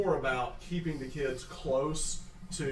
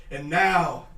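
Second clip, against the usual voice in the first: ordinary talk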